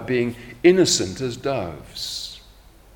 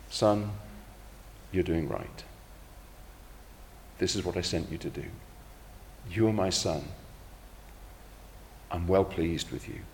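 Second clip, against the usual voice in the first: soft, low reassurance from a loving father; the Father encourages Jesus at his baptism, softly and tenderly; tender fatherly encouragement; 3 semitones lower, 9 dB quieter than usual. Acted. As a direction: speak softly to a son you are proud of.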